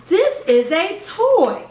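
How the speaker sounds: put-on "This is a toy" spoken slowly, each word shaped clearly for a toddler; slow over-enunciated. Shown as a demonstration. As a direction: show a parent how to talk slowly to a baby. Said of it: "let's practice".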